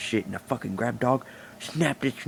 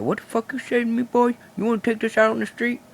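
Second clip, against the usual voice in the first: much higher, hammed-up angry challenge; mock angry challenge; 10 semitones higher than usual. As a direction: bark it like a street tough picking a fight. Other man challenges him to fight.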